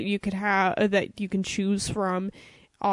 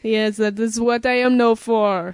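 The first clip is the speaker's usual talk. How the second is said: put-on French accent; 5 dB louder than usual. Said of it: "French accent I could do".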